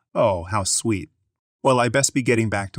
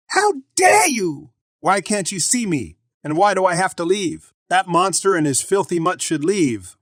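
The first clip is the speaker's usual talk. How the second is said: shrill, much higher, louder furious shriek, drawn out at first; angry yelling; 11 semitones higher, 5 dB louder than usual. Acted. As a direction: scream it at the nurse like an outraged customer. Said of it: "I've asked you to stop yelling".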